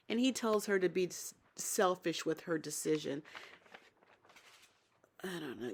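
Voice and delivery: ordinary talk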